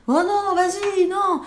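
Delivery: high, slow, whiny no; refusing kid. Retold or imitated. Boy refusing to hold her hand, whiny high refusal. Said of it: "le professeur insistait".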